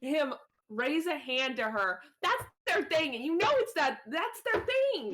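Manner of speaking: high-pitched, emphatic indignation; outraged protest. Real, not acted. Player objects that the gesture is their thing, indignant. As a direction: insist on it, pitch climbing at the end.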